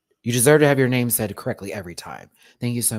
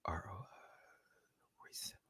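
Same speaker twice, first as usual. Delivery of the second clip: whispered sounding-out of a name; working it out privately; 6 semitones lower, 25 dB quieter than usual. Real, not acted. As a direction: whisper it to yourself to test it.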